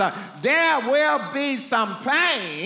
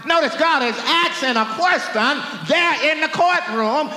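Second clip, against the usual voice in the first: higher and louder preacher's shout; full-force preaching; 4 semitones higher, 4 dB louder than usual. Acted. Preacher shouting to the congregation about God. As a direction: drive the point home to the pews.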